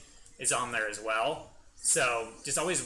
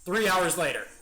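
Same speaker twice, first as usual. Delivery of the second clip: loud, raised time-card announcement; mock narrator; 6 semitones higher, 8 dB louder than usual. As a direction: announce it like a cartoon caption. Mock time-card inside Frieza skit.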